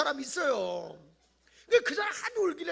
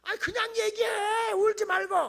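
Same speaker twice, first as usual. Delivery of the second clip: exasperated shout; 9 semitones higher, 6 dB louder than usual. Real, not acted. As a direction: plead with them to just speak. Preacher scolds crying prayers. (높고 크게 답답해하며 외치듯)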